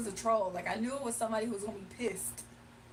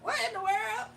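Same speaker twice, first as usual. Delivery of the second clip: high baffled exclaim; what on earth; incredulous; 9 semitones higher than usual. Retold or imitated. Reacting to odd caller.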